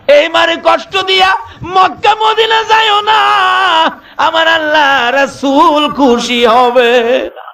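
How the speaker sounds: high, shouting sermon climax; preaching at full force. Acted. Preacher warning listeners not to hurt their mothers, full-force shout. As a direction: thunder the warning at the crowd.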